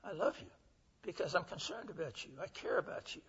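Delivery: soft, hushed loving assurance; tender. Retold or imitated. Preacher softly telling the inmates he loves them. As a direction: say it gently, like a confession of care.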